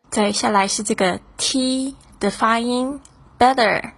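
ordinary delivery

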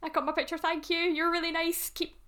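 high, polite mock goodbye; ready to flee. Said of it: "I was ready to flee".

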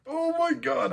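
very high, squeaky oh my God; squealing fan. Retold or imitated. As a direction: shriek it like a thrilled fan. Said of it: "And I was like".

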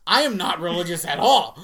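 loud, emphatic denial; hammed-up loud objection; mock protest. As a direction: insist on it in comic disbelief. Retold protest at praying.

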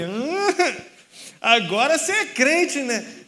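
high, loud mocking 'huh?' with a laugh; surpresa zombeteira. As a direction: react in loud, amused disbelief. Retrata a reação surpresa do homem. (surpresa debochada, aguda e alta)

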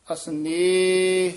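ਅਸਨੇਹ slow and syllable by syllable, with no extra sound added; careful pronunciation demo. Shown as a demonstration. Teacher models ਅਸਨੇਹ for the student, slow exact pronunciation. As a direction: say ਅਸਨੇਹ slowly and cleanly, no added lan. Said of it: "ਇਸ ਤਰ੍ਹਾਂ ਉਚਾਰਨ ਕਰਨਾ".

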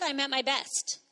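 ordinary delivery

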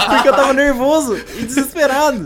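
high laughing speech; nervous laugh. Real, not acted. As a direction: laugh through the telling. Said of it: "eu dei mais risada". (rindo de nervoso)

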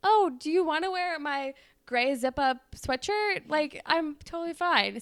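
a hint of a sweet boyfriend; gallant boy. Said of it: "And he's like".

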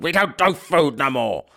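pissed-off pub landlady snapping down the phone; mock an irritated, curt phone refusal. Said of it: "couldn't hide her pissed off voice".

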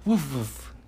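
parent barks woof woof; playful woof. Pretend. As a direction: echo the child's woof. Parent imitating dog.

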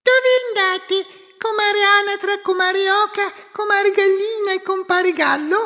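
very high, loud chirping bird; tiny squeaky goldfinch. Acted. Little bird speaks.

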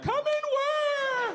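put-on very high, long-drawn old-man holler; baffled old man. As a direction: stretch it out, high and bewildered. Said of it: "This guy's like".

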